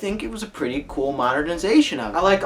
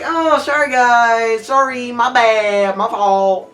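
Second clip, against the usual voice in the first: a hint of a sheepish apology, slowed; lightly voiced, slow mock sorry; 5 semitones higher, 4 dB louder, slower than usual. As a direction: mimic a lazy, careless apology. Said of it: "He's just like".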